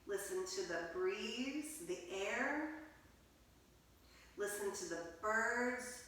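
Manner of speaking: soft, hushed meditation voice; guided meditation. Acted. Teacher guiding children into quiet listening, hushed. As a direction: settle the children into stillness.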